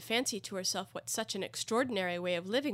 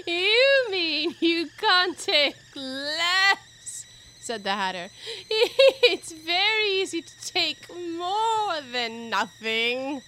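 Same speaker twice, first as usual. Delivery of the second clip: high, loud, drawn-out cackling voice; gleeful laughing taunt at full volume; manic Hatter; 9 semitones higher, 10 dB louder, much slower than usual. Acted. Hatter corrects Alice, with manic laughing glee.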